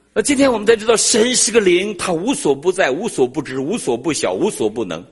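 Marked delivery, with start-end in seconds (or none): 0.1-3.4 higher, louder, slowing into proclamation; preaching. Acted. Preacher declaring God's nature to the church, rising proclamation. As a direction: proclaim it to the congregation.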